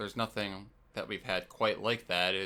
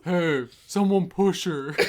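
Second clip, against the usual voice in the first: loud, drawn-out mock call; 8 semitones higher, 9 dB louder, slower than usual. Guildmate urging the raid to push someone, loud calling out. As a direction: call it out slowly across the group.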